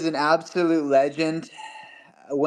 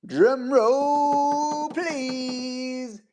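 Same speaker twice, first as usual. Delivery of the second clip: mock drum roll call; 9 semitones higher, much slower than usual. Announcing giveaway draw.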